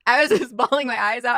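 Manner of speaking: laughing through the words. Real, not acted. Guest laughing at her own postpartum tears. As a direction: laugh as you recall bawling. Laughing while recalling crying.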